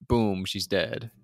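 normal talking voice

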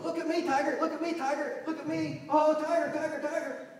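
put-on high, loud childish taunting; imitation of kids hollering at the zoo; mocking kids. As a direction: tease the tiger like a pestering kid. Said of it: "They're almost mocking".

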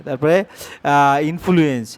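ordinary talk

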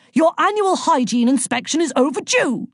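high, sharp official bark. A narrator's reading. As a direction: bark it like a stern inspector. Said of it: "she barked".

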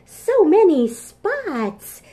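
slow, high, drawn-out amazement; delighted wonder. Acted. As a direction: savour the big count. Delighted exclaim at count.

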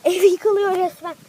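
loud startled outburst; shocked, like the house is falling. Real, not acted. Sudden crash during the chase.

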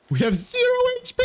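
fast high shriek; high panicked scream. Real, not acted. Panicking at zero HP.

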